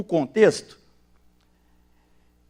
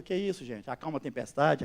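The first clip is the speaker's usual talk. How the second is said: much softer, unbothered; calm rebuke; 4 semitones lower, 10 dB quieter than usual. Retold or imitated. Jesus calmly answering the frightened disciples, much softer. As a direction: hush the storm with quiet authority.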